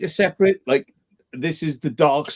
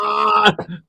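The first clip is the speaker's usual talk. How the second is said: shocked yelp; 6 semitones higher than usual. Real, not acted. Finishing shocked exclamation.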